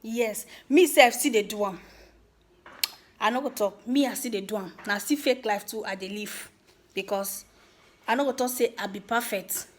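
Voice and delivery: ordinary talk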